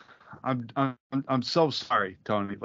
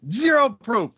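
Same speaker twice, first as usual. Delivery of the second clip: angry; 8 semitones higher, 5 dB louder than usual. Real, not acted. Arguing over who froze.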